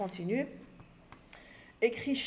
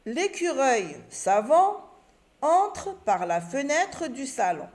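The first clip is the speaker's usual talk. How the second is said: slow, careful read with a rising pause after savant; slow reading aloud; 5 semitones higher, 5 dB louder, slower than usual. Shown as a demonstration. Teacher to pupils, reading the example sentence slowly. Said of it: "Et j'oublie pas le point à la fin".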